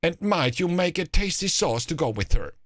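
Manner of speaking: high-pitched, eager ogress; urgent hungry ogress. A narrator's reading. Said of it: "in the urgent voice a hungry ogress has".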